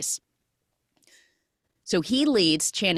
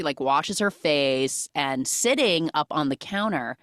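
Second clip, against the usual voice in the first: ordinary talk, nothing marked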